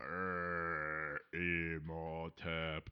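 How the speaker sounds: low, drawn-out spooky name; slow spooky voice. Pretend. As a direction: intone it slowly and low.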